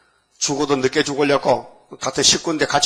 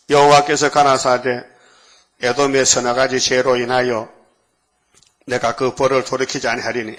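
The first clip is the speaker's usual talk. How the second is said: scripture reading; 3 dB louder than usual. Acted. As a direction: intone the verse like an oracle. Reads scripture solemnly.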